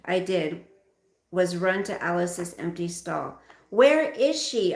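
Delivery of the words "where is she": loud, high demand. Acted. Girl demanding from her parents where the horse is, loudly. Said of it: "I demanded".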